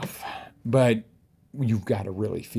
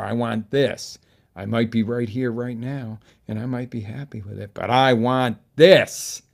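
determined desire; 8 dB louder than usual. Shown as a demonstration. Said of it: "That's the pleasure".

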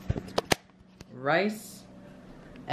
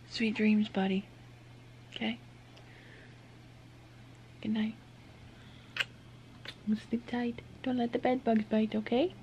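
sleepy talk to pet; 3 semitones higher, 9 dB quieter than usual. Real, not acted. Tucking child in for night.

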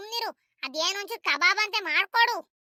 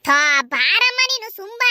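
exasperated scolding; 6 dB louder, slower than usual. Acted. Wife snaps at mother-in-law.

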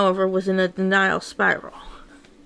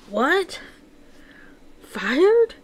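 high, startled question; can't believe the word; shocked disbelief; 7 semitones higher than usual. Acted. Manic hearing they are fired, shocked high rise.

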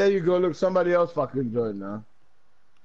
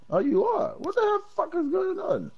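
baffled, voice flying up high; surprised. Real, not acted. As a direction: pitch shoots way up in disbelief. Confused by game invite.

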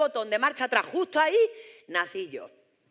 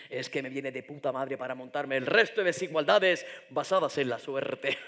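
a hint of a smug, lower seductive suitor; capitalism seducing patriarchy; 7 semitones lower than usual. Capitalism sweet-talks patriarchy, a touch smug and low. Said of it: "le dijo el capitalismo al patriarcado".

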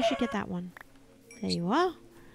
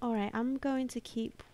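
ordinary talk

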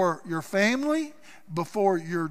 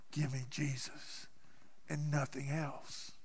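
much softer, near whisper; hushed devotion; 5 semitones lower, 15 dB quieter than usual. Real, not acted. Preacher to congregation about Peter's surrender, hushed. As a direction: say it like a prayer.

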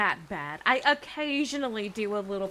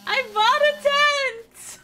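high, slow excited cry; 13 semitones higher, 8 dB louder, slower than usual. Real, not acted. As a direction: announce it thrilled. Excited game announcement.

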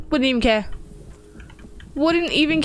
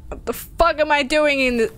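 usual speaking voice

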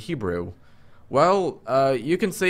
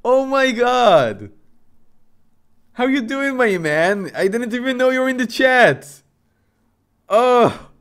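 high, loud, thrilled greeting; delighted to see a friend; excited; 7 semitones higher, 4 dB louder than usual. Real, not acted.

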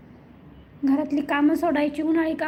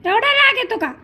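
louder, higher scolding of a sulky pet; 4 semitones higher, 4 dB louder than usual. Real, not acted. Owner scolds the sulking pet to get down. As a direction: tell him off firmly.

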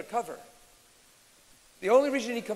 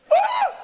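very high, loud startled shriek; mock frightened scream; 13 dB louder than usual. Preacher imitating people scared by the devil, mock scream. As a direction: scream as if suddenly spooked. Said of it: "he likes when we go".

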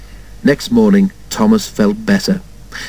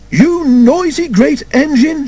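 high, slow, indignant scolding; acted angry shout, pitched way up; shouting mother; 14 semitones higher, slower than usual. A narrator's reading. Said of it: "shouted his mother".

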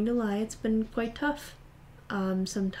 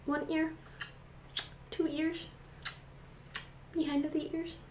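higher, softer cooing; 7 semitones higher, 9 dB quieter than usual. Real, not acted. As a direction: murmur sweetly up high.

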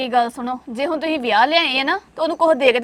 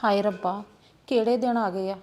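lamenting; 7 semitones lower, 10 dB quieter than usual. Acted. Aunty laments the times.

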